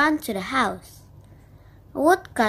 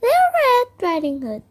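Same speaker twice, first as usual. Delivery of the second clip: put-on much higher sweet girl voice; little girl calling out; 12 semitones higher than usual. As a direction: call through the door like a little girl. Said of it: "she knocked on the door and called out".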